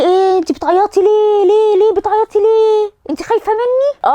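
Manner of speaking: high, put-on doctor voice; surgeon's voice. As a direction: play the surgeon teasing a crying patient. (الدكتور يسأل المريضة الباكية بصوت عالٍ مصطنع)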